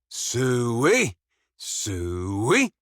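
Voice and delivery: drawn-out hog call swooping in pitch. Acted. Game character calling pigs with "suey", drawn-out hog call. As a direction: call the pigs, stretching each call out.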